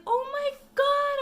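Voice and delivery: high, loud squeal of joy; excited squeal. Retold or imitated. Retelling the job offer call, a high excited squeal. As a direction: shriek it out, overjoyed. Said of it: "I was like".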